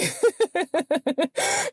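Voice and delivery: high-pitched burst of laughter; real laugh. Real, not acted. Laughing after admitting she nearly cried, high giggle.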